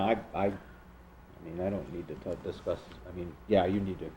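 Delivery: low, soft mumble; mumbling. Real, not acted. Board member hedging on what to give him, mumbling. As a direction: mumble it half to yourself, trailing low. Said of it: "I'm mumbling".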